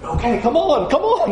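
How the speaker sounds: high, hushing then coaxing; mock crowd turning from hush to coax; crowd switching. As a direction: crowd first shushing, then urging him to come, pitched high. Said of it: "the crowd is going".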